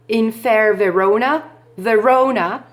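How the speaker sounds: slow "in fair Verona", leaning on the O of "Verona"; stress on the O. Shown as a demonstration. Teacher showing pupils how to scan, stress on the O of "Verona". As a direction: stretch and weight the middle O in "Verona". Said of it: "c'est le O qui va prendre l'accent tonique".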